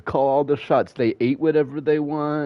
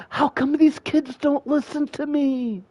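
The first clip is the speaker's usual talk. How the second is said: whiny mom. Retold or imitated. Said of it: "and she's like".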